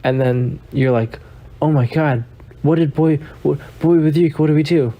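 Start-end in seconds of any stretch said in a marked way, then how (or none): 1.6-4.9 acted alarm over the missing chest; a playful hammed-up freak-out. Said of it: "So you start freaking out".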